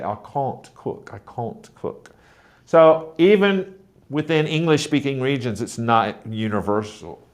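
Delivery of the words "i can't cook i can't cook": "can't" with a long broad ah, softly spoken; mild British "I can't cook", broad ah; British accent. Shown as a demonstration. American teacher imitating a British speaker's "can't". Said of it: "a British person would say".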